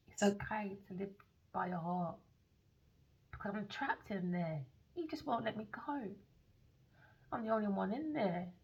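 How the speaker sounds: hushed gentle whisper; soft whisper. Retold or imitated. She comforts the lonely heart, soft hushed whisper. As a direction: comfort him softly. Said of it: "I said".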